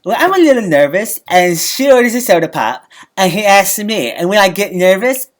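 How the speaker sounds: normal talking voice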